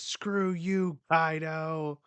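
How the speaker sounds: high, loud furious retort at Kaido; angry outburst. Retold or imitated. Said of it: "she gets so mad".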